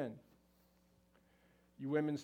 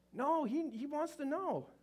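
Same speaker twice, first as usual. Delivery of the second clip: very high, exasperated insistence; insistent; 12 semitones higher, 5 dB louder, slower than usual. Pretend. Speaker correcting a doubting wife, insistent. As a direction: jump way up in pitch to insist.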